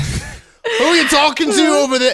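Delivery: high, quick mock-British call; British accent. Nosy Brit calls over to the man in the water, British accent. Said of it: "You guys are getting into British territory".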